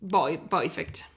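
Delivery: ordinary delivery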